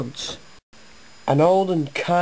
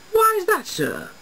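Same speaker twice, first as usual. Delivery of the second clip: shocked question. A narrator's reading. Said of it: "Finch shocked".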